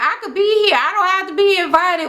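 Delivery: high-pitched mock protest; defensive comeback. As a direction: imitate someone arguing they belong. Acted quarrel, defensive comeback.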